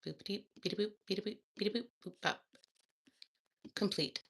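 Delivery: soft beeping robot noises; toy robot voice. Pretend. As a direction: beep like a little toy robot. Silly beeps while computing.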